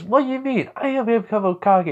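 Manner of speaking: put-on very high indignant kid voice; indignant protest. Boy insists he will become Hokage, indignant. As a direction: protest hotly, pitched right up.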